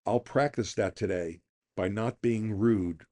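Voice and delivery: ordinary talk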